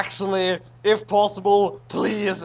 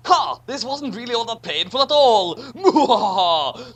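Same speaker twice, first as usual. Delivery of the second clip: gloating villain laugh; 5 semitones higher, 4 dB louder than usual. Pretend. Villain gloating, mock evil laugh.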